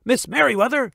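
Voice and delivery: high, urgent call down the hall. Acted. Mister McBee calling the head librarian urgently. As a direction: shout her name while running. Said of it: "he called".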